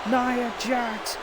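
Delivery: frantic, high play-by-play yelling; excited shout. Real, not acted. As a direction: scream the title change to the crowd. Title changes hands at the climax.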